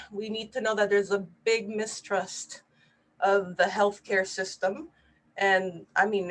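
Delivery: ordinary delivery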